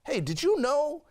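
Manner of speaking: high, loud, eager ask; eager high question. Retold or imitated. Telling his girlfriend on the phone, high and eager.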